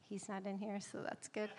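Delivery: hushed, breathy aside to the audience; whispered aside. Real, not acted. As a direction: drop to a secret whisper. Aside about absent son.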